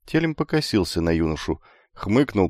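plain reading voice

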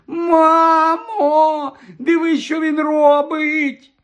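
very high, drawn-out tattling wail; squealing. A narrator's reading. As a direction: shriek to mother for rescue. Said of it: "заверещав Дадлі". (верескливо, протяжно)

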